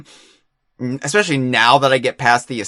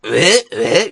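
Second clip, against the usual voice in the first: short ape grunts, slightly raised; Donkey Kong; 4 semitones higher, 4 dB louder than usual. Acted. Host copying his co-host's game noise, imitated ape grunts. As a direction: echo the chimp-like game hoots. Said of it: "trying to be Donkey Kong".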